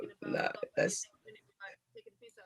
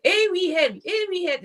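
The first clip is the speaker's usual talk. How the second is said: higher-pitched, unsure mock Scottish; attempted Scottish accent; 6 semitones higher than usual. Shown as a demonstration. Put on the spot by the host, tries a Scottish accent. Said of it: "I can't think of a Scottish".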